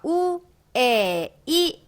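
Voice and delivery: 우, 에, 이 drawn out one by one; slow, separate 우, 에, 이; vowel breakdown. Shown as a demonstration. Teacher repeating the sounds for learners, vowel breakdown. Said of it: "이렇게 발음이 되는데요".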